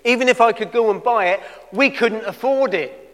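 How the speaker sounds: loud, high-pitched exasperated objection; indignant protest. Retold or imitated. Disciples telling Jesus they cannot afford food, indignant protest. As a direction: protest hard, as an acted-out disciple.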